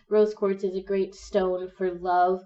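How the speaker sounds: ordinary delivery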